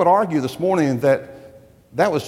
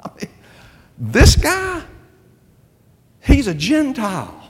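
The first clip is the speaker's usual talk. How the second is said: high, disbelieving objection to God; incredulous protest; 11 semitones higher, 3 dB louder than usual. Pretend. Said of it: "couldn't help but say".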